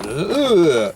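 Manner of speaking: mock action-hero groan, swooping up high; hammed-up drawn-out "ugh" grunt; Stallone. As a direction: imitate a movie star's wordless groan. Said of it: "and then Stallone says".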